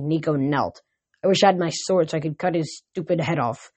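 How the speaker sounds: ordinary delivery, nothing marked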